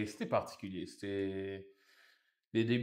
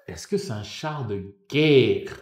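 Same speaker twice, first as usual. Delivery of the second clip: slow, louder bafflement, drawn out; incredulous question; 7 dB louder, slower than usual. Retold or imitated. Newcomer wondering what a char is, slow and incredulous. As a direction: act out stunned confusion, stretching the last word. Acted bafflement at unknown word.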